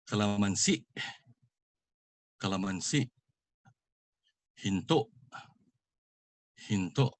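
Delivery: quick KALAMANSI and HINTO, stressed SI and TO cut off; final stress, glottal stop. Shown as a demonstration. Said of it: "pronounced fast with the accent on the last".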